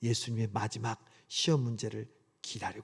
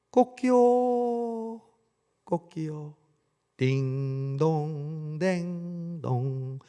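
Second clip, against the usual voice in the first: slow, stretched mock rooster crow; 2 semitones higher, much slower than usual. Rooster crow as the exam bell, drawn-out mock crow. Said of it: "닭 울음소리가 들립니다".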